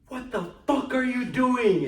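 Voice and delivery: high, drawn-out angry yell; loud, slow, outraged shout; chewing her out. Retold or imitated. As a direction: imitate a dad bellowing at her. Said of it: "chewing her out like that".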